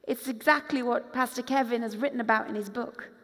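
normal talking voice

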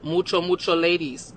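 ordinary delivery

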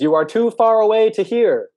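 high, loud, drawn-out holler; stretched-out shout to someone far away; shouting back. Acted. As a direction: call slowly over a great distance. Mole shouts back across the distance.

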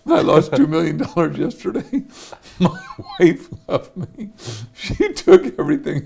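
real laughter breaking into a sad quote; laughing through the quote. Real, not acted. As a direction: laugh helplessly while retelling his bad news. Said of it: "I'm laughing now. I shouldn't be laughing".

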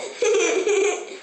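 high-pitched burst of laughter. Real, not acted. Owner cracking up at the dog's frenzy, high laugh. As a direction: crack up at the dog.

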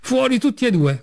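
high, furious yell of fuori; angry shout. A narrator's reading. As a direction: keep shouting them out the door. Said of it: "continuò a gridare".

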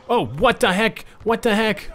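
high, startled "what the heck"; shocked disbelief. Real, not acted. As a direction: cry out in disbelief at the play. Shocked at a teammate's play.

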